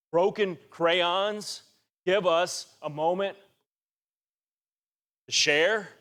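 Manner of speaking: "Broken crayons" stretched out very slowly; drawn-out preacher emphasis. Shown as a demonstration. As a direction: linger on each word of "Broken crayons".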